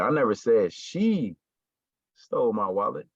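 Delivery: "she" drawn out, then a pause; stress on SHE. Shown as a demonstration. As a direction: punch the word "she". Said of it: "like, her".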